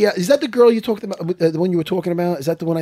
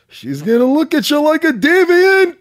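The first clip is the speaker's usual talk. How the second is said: higher, slow mock Longo voice; imitating Longo; 9 semitones higher, 4 dB louder, slower than usual. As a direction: do Longo's voice, dragging it out. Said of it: "you know how Longo talks".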